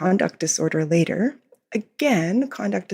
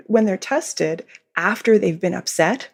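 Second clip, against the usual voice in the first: ordinary delivery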